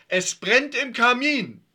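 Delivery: high, loud, stretched-out warning; rehearsed alarm. Acted. Yvonne scripts Dominik's greeting, declaimed alarm. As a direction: declaim the fire alarm like a stage line.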